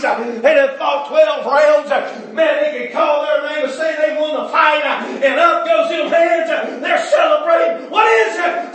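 loud, high shouted preaching; preaching at full force. Acted. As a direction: lift the congregation with the win. Preaching climax on boxers' victory.

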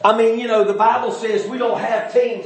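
usual speaking voice

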